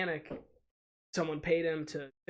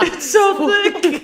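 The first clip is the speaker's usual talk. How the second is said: high, slow, laughing through it; 13 dB louder, much slower than usual. Real, not acted. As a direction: squeal it out while laughing. Laughing about thick foamy beer.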